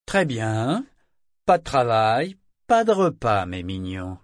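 biting fake kindness, rising then sweet; mock friendliness. A narrator's reading. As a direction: sneer it as a treat. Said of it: "une feinte affabilité si mordante".

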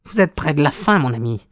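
high-pitched, mocking villain; gloating visitor. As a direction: taunt the sick man in a high voice. (visiteur moqueur à la voix aiguë)

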